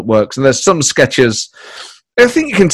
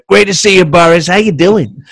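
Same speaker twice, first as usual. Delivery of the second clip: higher, louder Trump impression, hearty greeting; 6 semitones higher, 4 dB louder than usual. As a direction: greet Boris as a booming Trump. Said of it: "I would react to them as Trump's".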